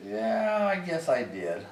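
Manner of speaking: drawn-out, high-pitched "yeah", then conceding; reluctant admission. Retold or imitated. Young airman admitting to a sergeant he deserved it, grudgingly. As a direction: give in with a long rising-and-sinking yeah. Sheepish admission after a scolding.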